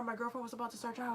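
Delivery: normal talking voice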